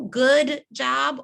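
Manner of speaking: a hint of scoffing emphasis; mocking the vague praise. Shown as a demonstration. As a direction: punch "Good job" as the empty example. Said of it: "Is that really specific though?".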